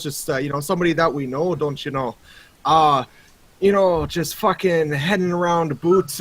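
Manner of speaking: put-on heavy Canadian hoser accent, chatty and rambling. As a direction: play it like a laid-back Canadian guy, bud. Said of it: "he said again in your accent".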